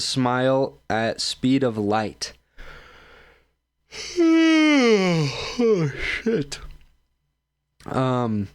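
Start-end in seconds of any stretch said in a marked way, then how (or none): 3.9-5.5 big tired sigh; heavy sigh. Real, not acted. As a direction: let out a long weary sigh.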